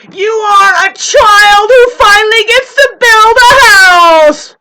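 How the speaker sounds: very high, slow, drawn-out yell; furious scream. Real, not acted. Scolding neighbors who are building, furious high scream. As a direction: scream it, stretching the last word.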